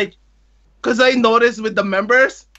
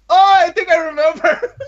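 higher, brightening retold realization; sudden recognition; 6 semitones higher, 4 dB louder than usual. Idol to returning fan, imitated sudden recognition. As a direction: imitate someone suddenly remembering a face.

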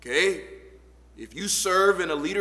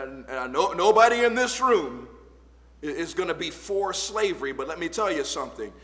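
usual speaking voice

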